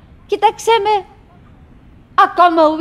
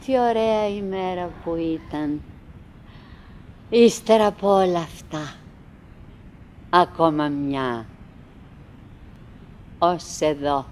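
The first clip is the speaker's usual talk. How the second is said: much lower, softer, slowing to a close; fading out; 9 semitones lower, 6 dB quieter than usual. Acted. Wife fading out softly at the end of the day. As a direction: let the day die away. Winnie winding down, ending.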